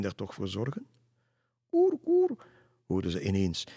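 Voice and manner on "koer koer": cooed high and louder like a dove; dove cooing. Acted. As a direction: coo it out like a bird overhead.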